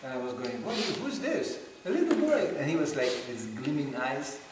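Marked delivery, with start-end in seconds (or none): 0.6-2.5 put-on high, bright little boy asking; a little boy's piping voice; mock child voice. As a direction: squeak it like a small curious kid. Voicing boy's question.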